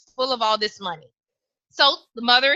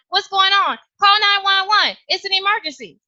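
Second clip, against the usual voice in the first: higher, louder panicked calls; alarmed shouting; 7 semitones higher, 4 dB louder than usual. Acted. Classmates shout for help when the girl passes out. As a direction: yell for help across the field.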